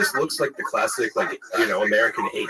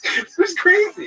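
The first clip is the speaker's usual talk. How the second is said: ordinary talk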